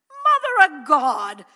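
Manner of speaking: a mother stunned at what she sees; loud, slow astonished exclamation; shocked mother. A narrator's reading.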